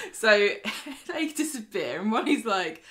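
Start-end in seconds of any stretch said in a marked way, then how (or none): none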